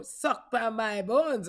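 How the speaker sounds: put-on slow, loud Jamaican accent catchphrase. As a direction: repeat the bit in a Jamaican accent. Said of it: "In a Jamaican accent?".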